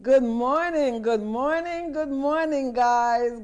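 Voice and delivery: loud, cheerful good mornings; rousing morning greeting. Real, not acted. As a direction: call out the greeting to viewers.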